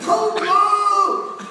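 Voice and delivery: high, drawn-out mock scream; child screaming. Child screaming after father in the car, hammed-up shout. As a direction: wail it loudly, stretching the last word. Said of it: "you can still hear him screaming".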